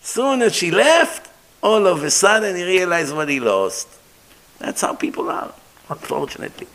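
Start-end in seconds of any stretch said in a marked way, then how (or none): 4.6-6.7 hushed, breathless aside; whispered regret. Real, not acted. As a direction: drop to a near whisper. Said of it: "sad aside".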